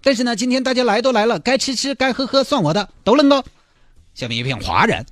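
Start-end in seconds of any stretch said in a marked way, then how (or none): 0.0-3.4 high bride voice. Acted. Bride announces wedding off.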